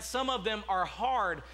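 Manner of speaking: normal talking voice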